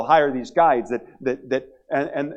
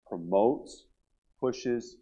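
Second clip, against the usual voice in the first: ordinary talk, nothing marked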